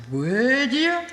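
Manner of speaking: high, teasing little ask; mock sweet request. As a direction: sweetly tease him into fetching them.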